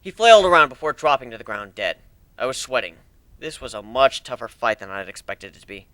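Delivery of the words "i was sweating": plain reading voice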